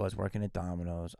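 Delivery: ordinary talk, nothing marked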